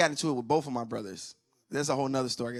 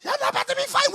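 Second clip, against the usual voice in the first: much higher stern mom warning; mom's warning; 9 dB louder, much faster than usual. Retold or imitated. Mom warning her sons not to fight. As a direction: mom laying down the law.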